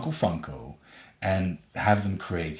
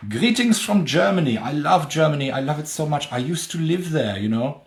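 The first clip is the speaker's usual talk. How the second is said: put-on over-the-top German accent; 7 semitones higher, 4 dB louder than usual. Greeting a German fan in a hammed-up German accent. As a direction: play up a thick German accent. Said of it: "my stereotypical over-the-top accent".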